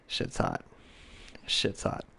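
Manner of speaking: breathy whispered aside, slow. Real, not acted. Telling viewers a girl who outplays you is hot, whispered. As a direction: lean in and whisper it like a secret.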